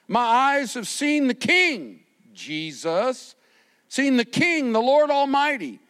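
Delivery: high, loud, slowing on the King; preacher quoting Isaiah to the congregation, fervent; fervent scripture preaching. Acted. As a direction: proclaim the verse with fire. Reading Isaiah with rising force.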